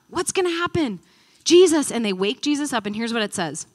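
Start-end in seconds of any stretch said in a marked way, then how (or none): 0.1-1.9 high, loud frightened plea; panicked cry. Acted. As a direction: cry out like men about to drown. Disciples panicking in the storm.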